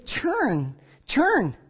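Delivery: drawn-out, imploring call to turn; pleading minister. Retold or imitated. God comes to Cain as a minister, pleading. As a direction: call them back like a pastor. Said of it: "as a priest, as a minister".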